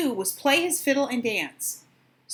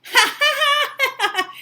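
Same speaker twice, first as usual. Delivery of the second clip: high loud ha ha ha; gleeful laugh; 6 dB louder than usual. Acted. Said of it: "laughed the two little pigs".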